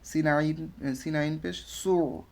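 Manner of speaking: usual speaking voice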